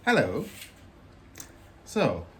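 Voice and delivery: ordinary delivery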